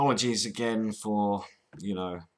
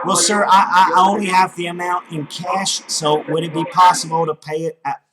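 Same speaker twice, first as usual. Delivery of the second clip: higher, louder put-on caller; loud phone voice; 4 semitones higher, 7 dB louder than usual. Prank caller asking scammer about paying, loud. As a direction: plead loudly down a bad line. Scam baiter posing as caller.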